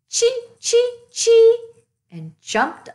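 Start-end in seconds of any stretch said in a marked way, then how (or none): 0.1-1.7 very high, loud chittering chee; squirrel chatter. Acted. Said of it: "A gray squirrel chattered".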